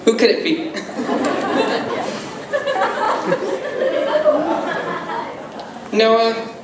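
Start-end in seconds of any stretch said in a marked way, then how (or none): 0.8-5.9 high breathless laugh. Real, not acted. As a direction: giggle helplessly before speaking. Speaker laughs before speech.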